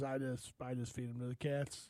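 low, quiet retold reply; Ray imitation. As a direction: imitate a buddy's subdued answer, low and soft. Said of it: "He goes".

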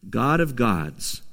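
usual speaking voice

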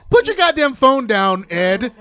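loud scolding. Real, not acted. Scolding Ed at the table.

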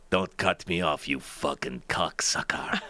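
low, slow imitation of road rage; a hint of a fuming German driver; mock angry driver. Angry driver curses traffic, low and slow mock rage. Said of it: "He'd be like".

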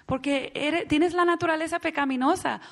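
ordinary delivery

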